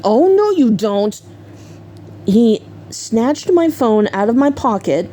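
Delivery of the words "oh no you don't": mock stern "not happening"; retold firm refusal. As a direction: put your foot down, retelling it. Boyfriend blocks her call home.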